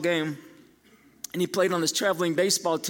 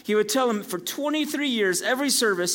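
usual speaking voice

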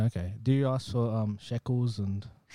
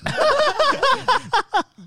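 loud high laugh; 9 dB louder than usual. Real, not acted. Laughing at her own confession.

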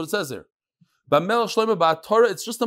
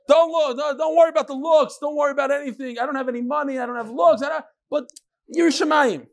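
put-on much higher pleading girl voice; mocking the girl; 9 semitones higher than usual. Girl pleading with a suitor, high mocking voice. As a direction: play the girl begging, pitched high. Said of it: "after she says".

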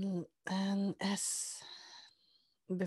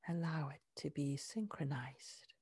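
guided meditation; 2 semitones lower, 3 dB quieter than usual. Acted. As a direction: ease them inward with soft breathy words. Heart-focus guided meditation.

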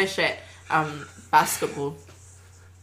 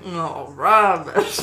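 loud, drawn-out mock dismissal; groaning fan heckle; 5 dB louder, much slower than usual. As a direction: groan it out slowly and loudly. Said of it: "That's more of a, like".

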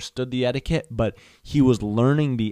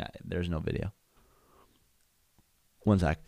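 ordinary talk, nothing marked

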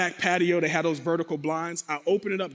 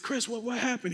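higher, softer stammered question; hushed alarm; 5 semitones higher, 6 dB quieter than usual. Retold or imitated. Man asking wounded friend what happened, hushed alarm.

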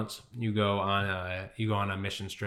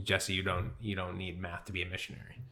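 soft, gentle and much quieter; gentle voice of God; 8 dB quieter than usual. Retold or imitated. God gently answering Jesse's cry over failing math, softly. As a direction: say it tenderly, like a kind father. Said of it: "like, so gently".

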